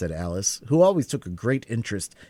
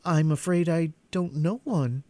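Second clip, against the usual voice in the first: rather alarmed; 9 semitones higher than usual. A narrator's reading. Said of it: "rather alarmed at the proposal".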